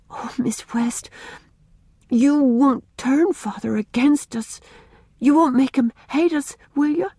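put-on high, slowed pleading child; beseeching. A narrator's reading. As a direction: beg her not to hurt them. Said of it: "she said beseechingly".

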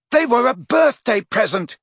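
high, loud defensive yell; shouting. A narrator's reading. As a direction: shout it while struggling in midair. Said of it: "shouted Ron".

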